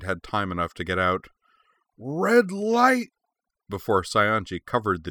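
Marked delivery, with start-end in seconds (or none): 2.0-3.1 very high, loud scream; piercing high-pitched yell; yelled battle cry. A narrator's reading. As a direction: scream it out in a burst of fury. Fighter yells out as temper bursts.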